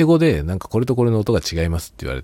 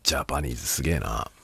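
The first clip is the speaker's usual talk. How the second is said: awed whisper; 5 semitones lower, 6 dB quieter than usual. Real, not acted. Awed aside to self.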